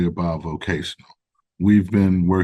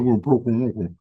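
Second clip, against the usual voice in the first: put-on thick Southern accent; 3 semitones higher than usual. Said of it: "real thick Southern accent".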